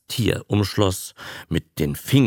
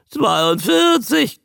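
slow, high, loud squawk; a weary newt forcing out the sum; straining squawk; 5 dB louder, slower than usual. Acted. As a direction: squawk the answer out with effort. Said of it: "quäkte der Molch mit Anstrengung".

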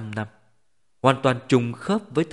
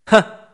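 loud, high single scornful laugh; cold scoff; 10 semitones higher, 11 dB louder than usual. A narrator's reading. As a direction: let out one cold laugh. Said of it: "cười lạnh một tiếng". (tiếng cười lạnh khinh khỉnh)

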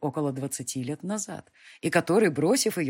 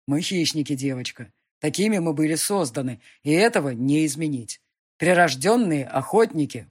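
loud, menacing demon lord; fire demon; 6 dB louder than usual. Acted. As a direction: predator boasting of his race. Demon speech continues.

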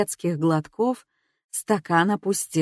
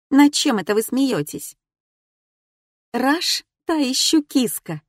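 excited; 6 semitones higher than usual. Acted. Laughing bar talk.

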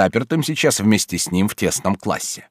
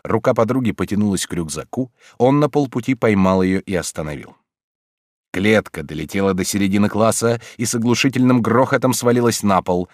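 plain reading voice

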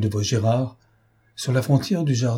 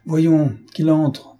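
exasperated; 6 semitones higher, 4 dB louder, much slower than usual. A narrator's reading. Plotin grudgingly admits visitor.

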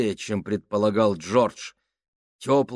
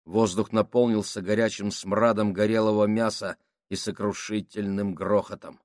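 usual speaking voice

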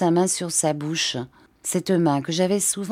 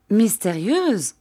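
drawn-out, higher-pitched questioning cry; a slow, raised exclamation; exclaiming; 5 semitones higher, slower than usual. A narrator's reading. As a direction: echo it back in mild indignant surprise. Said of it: "s'écria-t-elle".

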